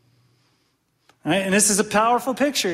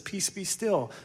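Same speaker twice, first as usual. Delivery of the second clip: calm command; 8 dB quieter, slower than usual. Retold or imitated. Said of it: "I don't even know if he shouts. He just says".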